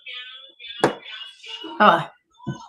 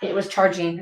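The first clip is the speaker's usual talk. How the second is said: normal talking voice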